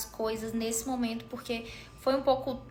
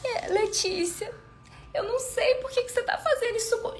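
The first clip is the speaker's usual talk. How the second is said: mock wounded innocence in a much higher voice; velvety victim voice; 11 semitones higher than usual. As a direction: play the innocent sweetly. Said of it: "A voz ficou aveludada na hora".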